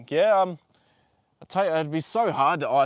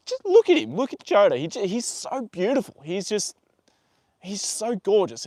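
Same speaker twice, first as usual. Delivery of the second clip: gushing excitement; 5 semitones higher than usual. Real, not acted. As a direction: squeal with delight at him. Gushing over his horse.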